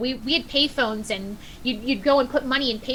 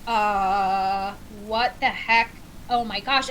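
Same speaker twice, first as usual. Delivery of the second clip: dazed disbelief; 3 semitones lower, much slower than usual. Retold or imitated. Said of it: "Uma Thurman Kill Bill thing where I'm like".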